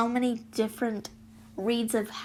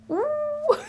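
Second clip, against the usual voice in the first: high, drawn-out teasing ooh; a mock-impressed coo. Teasing reaction to mom's rant.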